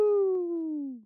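high whooping cheer; excited whoop. Real, not acted. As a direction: let out a gleeful woo. Cheering his own interest.

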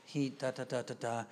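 low, soft, even gabble; calmed-down daughter. Retold or imitated. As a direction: imitate a child quietly explaining the trouble. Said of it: "when your voice sounds like mine".

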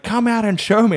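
high, quick motherly demand; mock mum calling someone out; imitated insistent mum. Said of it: "She'd be like".

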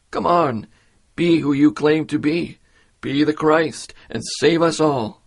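mocking. First criminal taunting Jesus on the cross, mocking. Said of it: "He's mocking him".

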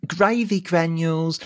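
high mimicked kid voice; imitating little boy. Back to the boy's catchphrase, high kid impression. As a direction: pitch it up in the lad's accent. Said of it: "in that accent".

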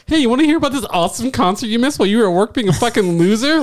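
high-pitched, rapid gloating chirp; mocking imitation of coworker. Coworker boasting to him about the missed concert, mock chirpy taunt. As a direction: rattle it off high, rubbing it in. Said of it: "she come in like".

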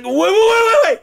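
very high, rapid stammered protest; mock panicked pleading. Geralt trying to halt the teleport, mock panic. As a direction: beg them to stop, high and comic.

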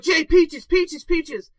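loud, high-pitched frantic calls; urgent calling. Acted. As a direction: shout the codeword to snap him out of it. Acting out calming codeword during night terror.

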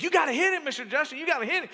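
put-on high, loud kid egging him on; a boy cheering on his pastor in a VR boxing game, high and loud; excited kid urging. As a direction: shout it like an eager boy cheering a fight.

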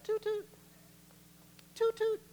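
high-pitched "toot toot"; playful train-whistle toot; high horn toot. Acted. Tooting own horn for praise.

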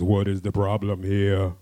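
loud, slow, commanding demand; big warrior voice. Acted. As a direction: loom over them and demand. Big warrior stops fight.

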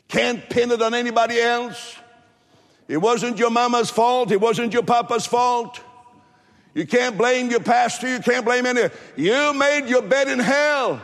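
high, insistent pulpit accusation; impassioned preacher, building to the last line; full-force preaching. Real, not acted.

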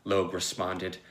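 plain reading voice

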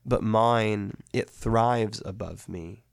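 slow, measured poetry reading; solemn recitation of an ode; poem reading. Acted. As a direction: read it like a hymn, unhurried. Reads the ode aloud.

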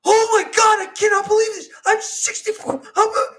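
high, loud imitated meltdown; retold customer's high-pitched freak-out; losing her shit again. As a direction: wail it in mock outrage, way up high. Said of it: "lost her shit again".

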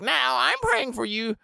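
put-on high old lady voice; sweetly pointed prayer; pious old neighbor. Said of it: "she's like".

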